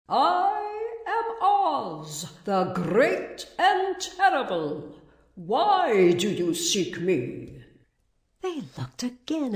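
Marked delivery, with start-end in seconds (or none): 0.1-7.6 grand, drawn-out proclamation in a high, put-on voice; mighty disembodied Oz. A narrator's reading. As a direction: intone it slowly as an all-powerful wizard. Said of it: "and it said".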